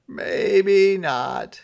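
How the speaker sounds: high, loud put-on Santa voice. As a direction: play him sulky and fed up.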